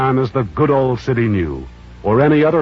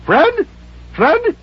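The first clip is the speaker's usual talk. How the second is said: put-on old Scrooge calling. Scrooge calling to his nephew Fred, high cry. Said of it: "cried Scrooge".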